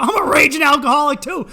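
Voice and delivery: high, loud, thrilled agreement; excited shout. Acted. Divorced guy meeting a fellow drinker, a high, loud, delighted shout. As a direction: blurt it out like you found your soulmate.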